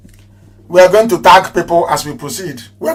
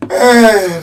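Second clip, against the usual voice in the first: normal talking voice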